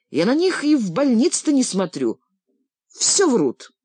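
outraged, push back hard; indignant. A narrator's reading. Indignant refusal of the scales.

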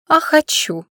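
high tipsy giggling reply; giggly drunk. Acted. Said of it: "Лера пьяно хихикнула".

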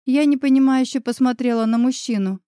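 usual speaking voice

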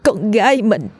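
a stunned mother shaking as she speaks; mother trembling as she tells her husband their daughter is inside the teacher. Acted. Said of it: "Bà Lương rung giọng nhìn chồng xúc động".

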